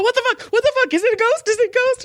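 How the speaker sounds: high, frantic what the fuck; panicked patient. Retold or imitated. Spooked massage patient fearing a ghost, high frantic squeal. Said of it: "He was like".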